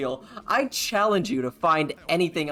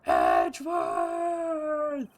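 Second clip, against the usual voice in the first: even higher and longer shrieked name, very slow; Von Karma scream; 13 semitones higher, much slower than usual. Retold or imitated. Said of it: "the signature Von Karma scream".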